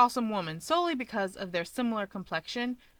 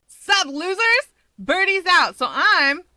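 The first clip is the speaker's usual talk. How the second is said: loud brash greeting; 10 semitones higher, 9 dB louder, slower than usual. Acted. Mirko bursts in loudly.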